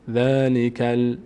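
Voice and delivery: ذَلِکَ ال- slow, ending on a still L; unvowelled lam demo. Shown as a demonstration. Teacher warning the lam must not move, slow demo. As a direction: draw out ذَلِکَ ال- and stop dead on the L. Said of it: "لام حرکت نکنه".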